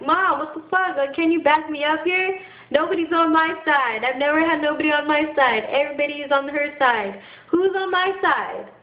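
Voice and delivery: high-pitched, hurt protest; upset appeal. Retold or imitated. Appealing to mom for back-up, upset protest. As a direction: plead for backing, pitched well up.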